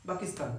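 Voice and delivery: পাকিস্তান said as bakistan with b; Arabic b for p. Retold or imitated. Teacher shows how Arabs say Pakistan, b sound. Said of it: "ওরা বা দিয়ে উচ্চারণ করে".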